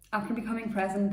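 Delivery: ordinary delivery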